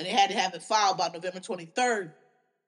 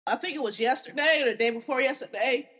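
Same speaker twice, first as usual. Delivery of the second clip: normal talking voice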